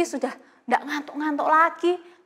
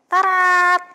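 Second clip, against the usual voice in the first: ta-da reveal; 6 semitones higher, 8 dB louder than usual. Acted. Showing finished paper craft.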